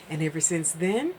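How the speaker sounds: ordinary talk, nothing marked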